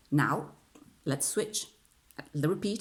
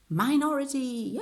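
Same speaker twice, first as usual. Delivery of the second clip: high, loud topic reveal; loud announcement; 10 semitones higher, 5 dB louder than usual. Shown as a demonstration. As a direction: belt the word out high after the hush. Same word repeated loudly after hush.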